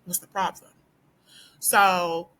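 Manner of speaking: ordinary delivery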